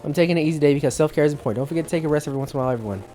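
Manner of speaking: rushed, running words together; fast reading. Real, not acted. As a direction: rattle through it. Reading a post aloud fast.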